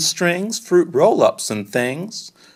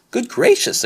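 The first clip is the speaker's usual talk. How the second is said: high, delighted mother's gasp; mother exclaiming; 8 semitones higher than usual. Acted. Mother praising her toddler's tower, high and delighted. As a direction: gush like an amazed mom. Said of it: "his mother exclaimed".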